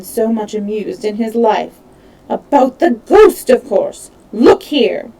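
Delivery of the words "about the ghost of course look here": heated. A narrator's reading. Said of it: "She suddenly calmed herself".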